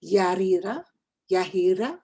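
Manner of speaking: "Yarira, Yahira" tried out, rising like a question; tentative name attempts. Shown as a demonstration. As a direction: try "Yarira", then "Yahira", checking each. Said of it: "I don't think I'm pronouncing".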